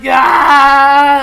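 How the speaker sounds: loud high startled "Ah!"; friends' startled loud yell; alarmed shout. Retold or imitated. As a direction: yelp like friends spooked. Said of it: "they're like".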